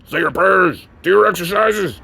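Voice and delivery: loud wrestler's sign-off voice; wrestler catchphrase. Acted. Signing off with Hulkster's motto, loud wrestler voice. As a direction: punch it out like a wrestling promo.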